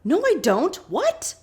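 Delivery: high-pitched mock panic; imitated panicky protest; panicked. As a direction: deny it in a flustered, rising squeak. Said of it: "Shannon panics and goes".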